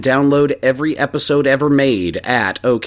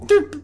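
small fart noise. Retold or imitated.

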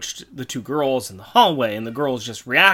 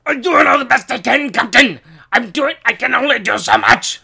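high, loud Scottish alien engineer; harried Scottish creature voice, pitched high; Snark voice; 9 semitones higher, 6 dB louder than usual. Shown as a demonstration. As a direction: strain it out as a Scottish monster. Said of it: "see if I can do this with a Snark voice".

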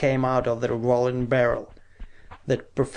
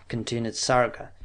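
ordinary delivery

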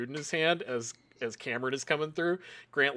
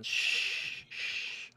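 soft, long "shh"; drawn-out shushing; 7 dB quieter than usual. Acted. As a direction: shush them softly and slowly. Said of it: "just going".

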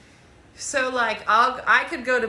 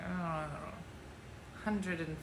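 much softer, slow unsure mumble; muttering to herself; 5 semitones lower, 13 dB quieter, slower than usual. Retold or imitated. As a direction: drop to a quiet, slow murmur. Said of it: "And I was like".

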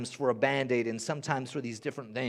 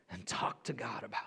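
soft intimate whisper; preacher whispering to the congregation; 5 semitones lower, 10 dB quieter than usual. Real, not acted. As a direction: drop to a hush, breathy and close. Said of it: "whispered sermon moment".

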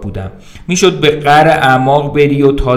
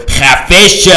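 cop's shut up; 6 semitones higher, 7 dB louder, much slower than usual. Acted. Second cop orders the drunk to shut up, loud. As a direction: shut him up hard.

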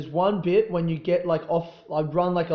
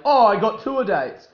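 loud, higher mock podcast host; Ari Shaffir; 7 semitones higher, 9 dB louder than usual. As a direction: blurt out a sudden tangent, imitating a stoner host. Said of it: "then he'll be like".

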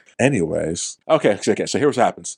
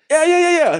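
excited; 4 dB louder than usual. Real, not acted. Eager agreement cutting in.

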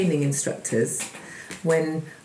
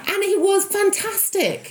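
ordinary talk, nothing marked

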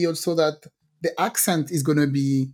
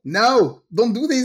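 higher, louder telling-off; mock parental scolding; 6 semitones higher, 5 dB louder, slower than usual. Parent telling little boy off, mock scolding. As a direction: scold a small child, in play.

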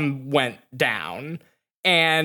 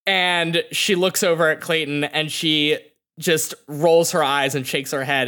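ordinary delivery

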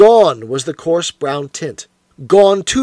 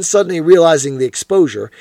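usual speaking voice

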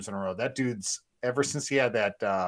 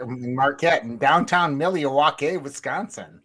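loud, slow, hammed-up Wisconsin accent; exaggerated American accent; 3 semitones higher, 6 dB louder, slower than usual. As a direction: overdo the Midwestern American place names. Mock-awkward over-American place names.

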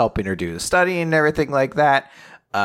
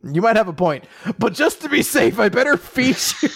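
higher, earnest, laughing at the end; hammed-up doting reply; mock earnest boy; 10 semitones higher than usual. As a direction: insist with a straight face. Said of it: "he's like".

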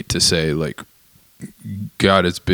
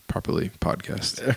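whispering; 2 semitones higher, 12 dB quieter than usual. Real, not acted. Self-mocking trailing whisper.

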